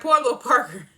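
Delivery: high, loud, laughing pity; loud, high mock pity. Real, not acted. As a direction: cry out in amused sympathy.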